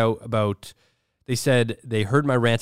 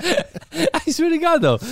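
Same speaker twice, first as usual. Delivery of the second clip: high, drawn-out laughing protest; 4 dB louder, slower than usual. Real, not acted. Host amazed they are both tearing up, laughing hard. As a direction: swear it through helpless laughter.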